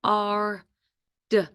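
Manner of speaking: H-R-D as separate sounds "huh, ar, duh"; sounded out. Shown as a demonstration. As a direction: stretch H-R-D sound by sound with pauses. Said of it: "Four letters".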